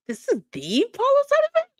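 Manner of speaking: high, loud incredulous question; incredulous squeal. Real, not acted. Host watching the clip with viewers, squealing in disbelief. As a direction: shriek it in total disbelief.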